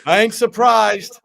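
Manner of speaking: loud, drawn-out meme quote; Diaz meme impression. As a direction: belt it out slowly, then crack up laughing. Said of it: "get that meme up of".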